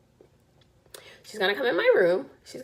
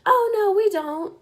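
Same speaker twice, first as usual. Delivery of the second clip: polite denial; 10 semitones higher, 4 dB louder than usual. Retold or imitated. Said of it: "And I'm like".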